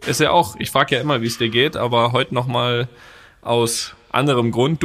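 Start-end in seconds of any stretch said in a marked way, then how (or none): none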